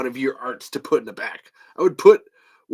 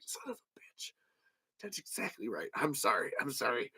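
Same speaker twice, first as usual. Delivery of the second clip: much softer whispered concession; defeated whisper; 3 semitones lower, 14 dB quieter than usual. Retold or imitated. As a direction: hush it out, caught and apologetic.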